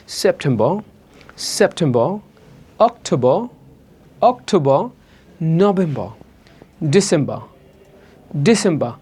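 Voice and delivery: "সেপ্টেম্বর, অক্টোবর" ending on an open vowel, "septemba"; British silent final R. Shown as a demonstration. Teacher showing British-style month endings, silent R. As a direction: drop the last R in "ডিসেম্বর": "disemba". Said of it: "সাধারণত ব্রিটিশরা পড়ে না".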